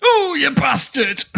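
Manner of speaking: high, slow, drawn-out "Ooh, you bastard!"; buzzer sound shout. Shown as a demonstration. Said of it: "wanna hear it".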